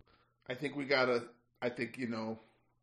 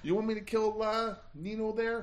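Hoagie mouth accent; 7 semitones higher than usual. Shown as a demonstration. Player switches his hitman to a Philly Hoagie mouth accent. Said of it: "I'll do Hoagie mouth".

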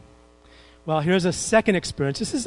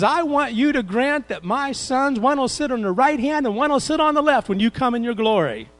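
pleading mother; 8 semitones higher, 4 dB louder than usual. Retold or imitated. Mother asks Jesus for sons.

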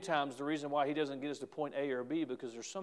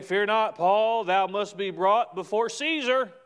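proclaiming angel; 5 semitones higher, 7 dB louder than usual. Acted. Angel's words proclaimed loudly.

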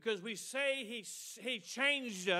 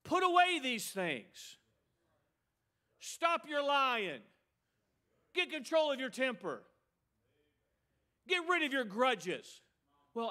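forceful preaching; 4 semitones higher than usual. Real, not acted. Preacher urging church to drop grudges, forceful exhortation. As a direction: preach each command out firmly.

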